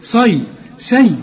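slow صي then light سي; مقارنة بطيئة بين الصاد والسين; sad versus seen contrast. Shown as a demonstration. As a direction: set heavy صي against thin سي slowly. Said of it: "ما تقولش صيرا".

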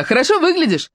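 high, ringing call; shouted teasing. Acted. Ruby calls out, teasing Claude. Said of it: "крикнула она".

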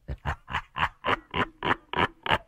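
low, soft, hollow villain laugh; slow staccato ghostly chuckle; menacing broken cackling; evil cackle. A narrator's reading. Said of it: "Thằng Sinh cười lên sằng sặc".

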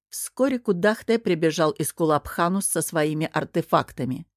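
ordinary delivery, nothing marked

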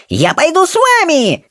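very high panicky squeal. Acted. Rolla leaps up to follow searchers, shrieky high squeal. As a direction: squeal it as you jump up. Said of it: "завизжал он".

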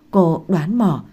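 ordinary delivery, nothing marked

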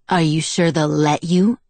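scathing question in a put-on voice. A narrator's reading. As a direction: ask it with withering doubt. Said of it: "in a scathing tone".

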